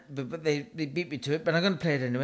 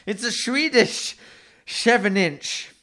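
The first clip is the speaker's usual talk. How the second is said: ordinary talk, nothing marked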